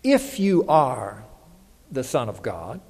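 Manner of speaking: If slowed and lingered on; conditional if stressed. Retold or imitated. Preacher quoting Satan, leaning hard on If. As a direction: drag out the word If with suspicion. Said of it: "He said".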